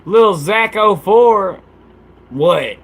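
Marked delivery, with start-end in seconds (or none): 0.0-1.6 slow shout-out. Real, not acted. Shout out to a viewer.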